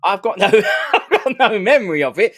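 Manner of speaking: laughing. Real, not acted. Host laughing to his guest about forgetting Newcastle, giggly. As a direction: laugh out the admission.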